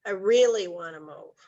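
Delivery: "really" stretched and punched, the rest falling away; stress on REALLY. Shown as a demonstration. Trainer showing agents how emphasis shifts meaning, stress on "really". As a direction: hit the word "really" in "I really wanna move". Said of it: "where they put the emphasis".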